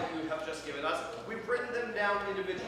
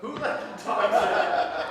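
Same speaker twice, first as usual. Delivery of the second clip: announced repeat; 2 semitones higher, 8 dB louder, slower than usual. Shown as a demonstration. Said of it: "host repeats lyric".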